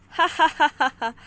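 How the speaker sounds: loud jeering ha ha ha; scornful pirate cackle; mocking laugh. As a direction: laugh him off as a joke. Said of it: "खिल्ली उड़ाता है".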